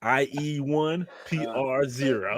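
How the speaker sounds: high, slow, playful score call; mock score announcement. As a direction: call out a winning score, drawn out. Jokingly announces a victory score.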